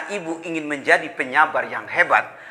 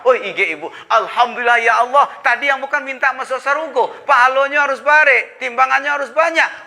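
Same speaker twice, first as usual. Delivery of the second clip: exaggerated gratitude; 6 semitones higher than usual. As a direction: gush with thanks to God. Quoting wife's grateful reply.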